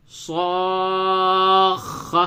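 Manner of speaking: صاخ drawn out very slowly, kha held long; doubled kha. Shown as a demonstration. Teacher models the doubled kha very slowly. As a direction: stretch صاخ and hold the final kha. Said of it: "تو یوں بولیں".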